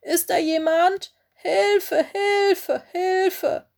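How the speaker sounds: high-pitched, urgent calls for rescue; repeated high cries, each falling away; calling for help. A narrator's reading. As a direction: call out high as if stranded. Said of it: "rief Willis".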